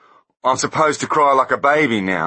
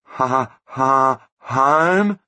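high, stuttering nervous laugh; stammering; 6 semitones higher, 3 dB louder, much slower than usual. A narrator's reading. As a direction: stammer out the word. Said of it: "stammered Blunt".

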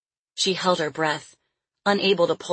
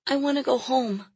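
weak pleading voice; 8 semitones higher than usual. Acted. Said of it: "she cried in a weak voice".

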